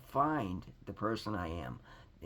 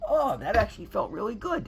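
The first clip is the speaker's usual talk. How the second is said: high, loud giddy laugh-talk; relieved laughing after the phone call; laughing relief; 9 semitones higher, 7 dB louder than usual. Retold or imitated. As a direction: let the relief burst out in a laugh.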